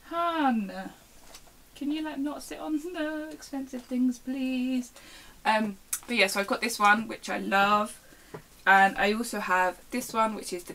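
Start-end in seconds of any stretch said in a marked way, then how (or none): 0.1-4.9 pet-directed pleading. Real, not acted. Pleading with cat sitting on stuff.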